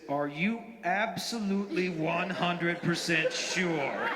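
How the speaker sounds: very slow, deliberate question; grave double-check. Retold or imitated. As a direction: draw out each word to make certain. Carlos gravely double-checks the bite.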